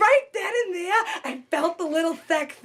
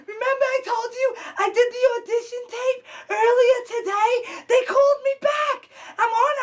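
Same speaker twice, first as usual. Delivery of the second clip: excited phone call; 6 semitones higher than usual. Acted. Calls dad with big news.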